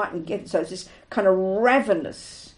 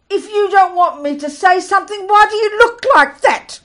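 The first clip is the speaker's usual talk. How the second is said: higher and louder, slowing into an offended question; retold indignant Italian man; 9 semitones higher, 9 dB louder than usual. To the teacher who shouted at him, an indignant, louder retort. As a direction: play the hurt man who feels falsely accused. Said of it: "And he said".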